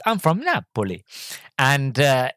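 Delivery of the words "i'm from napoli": higher mock Italian accent. Passenger answering the driver, innocent Italian accent. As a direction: innocent Italian, lightly caricatured. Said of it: "he's like".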